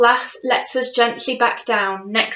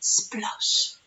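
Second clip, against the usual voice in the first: breathy whispered splash sound; soft hushed splosh; 6 dB quieter than usual. Shown as a demonstration. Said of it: "Can you make that sound?".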